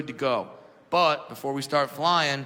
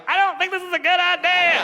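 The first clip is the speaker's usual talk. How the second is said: high, frightened squeak of a grown man; scared whimper; 13 semitones higher, 6 dB louder than usual. Pretend. Grown man squeaking in fear on helicopter. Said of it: "I can't be a thirty-year-old man like".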